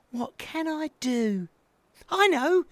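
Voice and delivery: put-on high baby owl voice, pondering then bright idea; little owl hitting on an idea; thinking out loud. A narrator's reading. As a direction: wonder aloud in a little high voice. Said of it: "he thought out loud".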